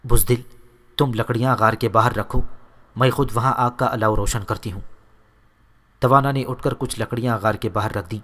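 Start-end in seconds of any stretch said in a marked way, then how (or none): none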